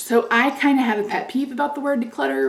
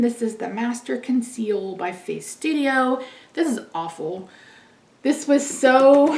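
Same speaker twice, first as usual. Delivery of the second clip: ordinary talk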